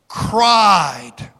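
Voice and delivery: "cried" drawn out and ringing; preacher stresses "cried" with a shout; stressed cried. Shown as a demonstration. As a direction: lean hard into "cried". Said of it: "it doesn't say he prayed".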